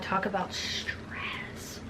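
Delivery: very soft, slow whisper; hushed aside. Real, not acted. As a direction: drop to a drawn-out whisper. Recalling school reading-aloud anxiety.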